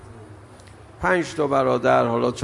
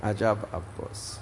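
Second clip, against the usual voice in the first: hushed lament; 4 semitones lower, 9 dB quieter than usual. Acted. Mourning praise of Abbas.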